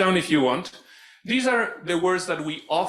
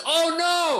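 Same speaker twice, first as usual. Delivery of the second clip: high, drawn-out mock "oh no"; playful high-pitched exclamation of dismay; group cry of dismay; 13 semitones higher, 3 dB louder than usual. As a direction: chant it like a whole team reacting. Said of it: "everyone in the team says".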